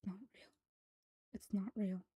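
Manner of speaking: repeated hushed muttering; muttered under breath. A narrator's reading. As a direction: mutter it softly under your breath, trailing into disbelief. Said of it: "repeatedly mutters something under her breath".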